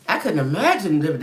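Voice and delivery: ordinary delivery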